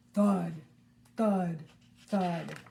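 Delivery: loud, falling thud on each; heavy thuds. Shown as a demonstration. Reader leading children in giant footsteps, loud. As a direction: stomp each word like a footstep.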